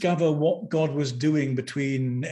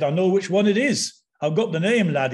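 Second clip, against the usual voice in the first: louder, higher Yorkshireman impression; Yorkshire accent; 5 semitones higher, 5 dB louder than usual. Exorcist from Leeds claiming command of a spirit, imitated Yorkshire accent. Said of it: "I'm sorry about my accent".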